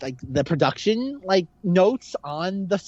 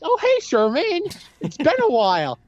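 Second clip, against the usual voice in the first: mock Stifler greeting; 10 semitones higher than usual. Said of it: "he's like".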